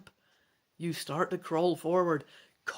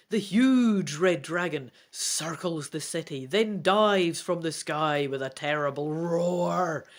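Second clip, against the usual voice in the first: a louder, slower read that builds as the dragon attacks; dramatic story narration; 5 dB louder than usual. A narrator's reading.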